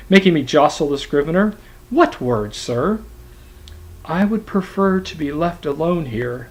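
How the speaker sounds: literary reading with acted voices; dramatic reading aloud. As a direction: perform the text for the class with energy. Reads Melville passage aloud.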